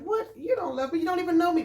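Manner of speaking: high-pitched startled protest; retold shocked inner outburst; incredulous. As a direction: shoot up high in disbelief. Her startled thought at love confession.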